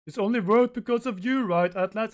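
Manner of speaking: very high, loud tearful yell, acted; crying yell. Usopp yells to Atlas in gratitude, crying. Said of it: "it's crying and yelling it out".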